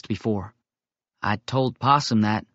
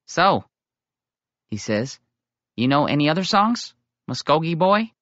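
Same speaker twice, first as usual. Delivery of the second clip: higher, playful questioning; teasing quiz; 4 semitones higher than usual. Acted. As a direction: sidle up and test him. Little Coon quizzes Tommy.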